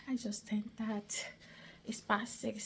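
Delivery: hushed whisper to the camera; whispering so no one hears. Real, not acted. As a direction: keep it secret, barely breathe it out. Sneaking home, speaking softly.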